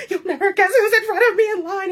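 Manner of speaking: high, loud, laughing tease; giddy gossip. Retold or imitated. Texting her best friend about the crush, giddy and laughing. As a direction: dish the news with giggly glee. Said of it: "I'm texting her and I'm just like".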